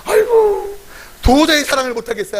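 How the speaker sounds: high wailing 아이고, then a pleading complaint; mock anguished cry. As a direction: play up the despair of the struggling believer. Mock anguished prayer to God. (하나님께 하소연하는 과장된 탄식)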